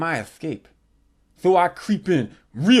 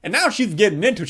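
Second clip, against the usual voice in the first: ordinary talk, nothing marked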